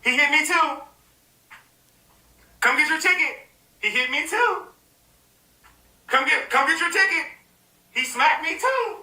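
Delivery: mock the women joining in; mock accuser voice. Mocking accusers lining up in a high whiny voice.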